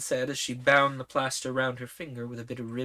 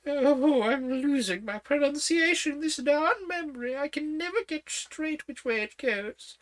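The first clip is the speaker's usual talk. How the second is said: put-on high, fussy old queen; 13 semitones higher than usual. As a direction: talk up high like a flustered queen.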